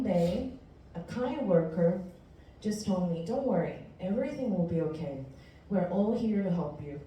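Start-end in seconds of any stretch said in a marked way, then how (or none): none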